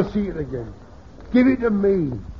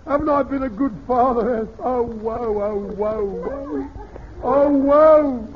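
wailing "oh, woe", higher and building to a loud cry; hammed-up wailing; 6 semitones higher, 4 dB louder, slower than usual. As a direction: ham up a theatrical father's lament. Mock grief, wailing woe.

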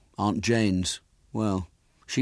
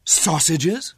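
horrified; 9 semitones higher, 5 dB louder than usual. Acted.

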